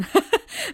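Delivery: quick rising giggle; a bright little laugh; short laugh. Real, not acted. Host giggles after telling listener to grow up.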